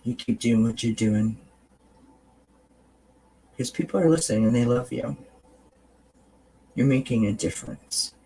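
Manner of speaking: tender reassurance to a tired friend in the mirror; kind mirror talk. Shown as a demonstration. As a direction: talk to yourself like your best friend. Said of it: "And you say this".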